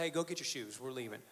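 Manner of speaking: low, deflated parental order; resigned dad. Retold or imitated. Dad with nothing left to say hurries son along, resigned. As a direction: give up and send the kid off, low and subdued. Defeated dad ends the teachable moment.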